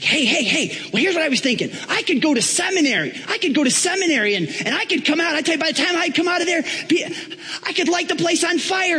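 high, hammed-up excitement; excited Donald Trump. Apprentice telling Paul his seminary plans, all excited. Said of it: "Donald gets all excited".

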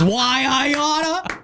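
mock shaking fist at a rascal; hammed-up old-timer threat. Radio hosts trying out an old saying, drawn-out mock threat. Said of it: "That should be the next line".

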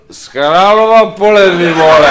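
loud, high, drawn-out holler; opilecké zahulákání. Acted. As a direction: bellow it slowly down from above, drunk. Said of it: "se na něj podíval z vrchu a říkal". (táhle a hlasitě zahulákat shora)

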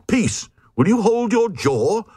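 high, odd crowd voice crying out; the Glugs protest in a strange high cry. A narrator's reading. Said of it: "the Glugs cried".

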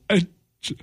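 high, slow mock-tearful voice; halting, weepy put-on voice; imitated crying. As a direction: impersonate someone choking up with bad news. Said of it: "and she starts crying".

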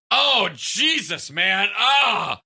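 high, drawn-out groaning protest; retold disgusted outcry. Host recoiling from a co-worker's fart, retold disgusted outcry.

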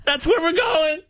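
very high, thrilled caller squeal; excited caller. Acted. Caller telling radio hosts about a vacation, very excited. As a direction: gush it, high and giddy with excitement. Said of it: "he's very excited".

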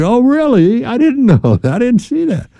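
high, feigned surprise, playing dumb; mock innocent surprise. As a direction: act like you never saw the light. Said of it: "I'd be".

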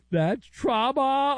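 high, slow mock panic over "That's trauma."; mock shriek. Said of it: "mock shriek version".

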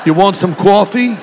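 hammed-up East Coast vowels; mock East Coast accent. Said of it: "Or East Coast".

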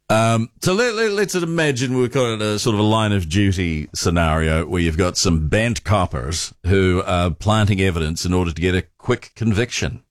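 normal talking voice

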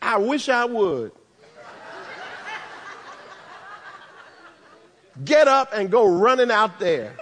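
laughing, defiant scoff; Mary refusing to run out to Jesus, with a laughing scoff; sister with an attitude. Acted. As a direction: toss it off with sass. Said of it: "a sister whose attitude is".

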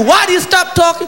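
ordinary talk

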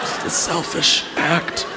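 soft, slow, near-whispered verdict; slow, hushed mock reproach; hushed disapproval. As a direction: murmur it slowly in mock disappointment.

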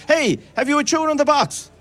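high, carrying call across the street. Real, not acted. Presenter calls out to a passing boy carrying a box.

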